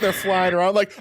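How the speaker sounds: ordinary talk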